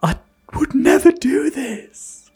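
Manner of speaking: hammed-up protest sinking into whispery menace; mock innocence. Suspect denying murder to the policeman, mock innocence.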